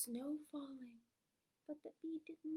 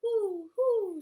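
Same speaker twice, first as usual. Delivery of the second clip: high, loud owl hooting; loud storybook owl call; 6 semitones higher, 14 dB louder than usual. Acted. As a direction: hoot it out like an owl. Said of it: "said a fat old owl".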